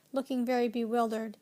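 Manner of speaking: usual speaking voice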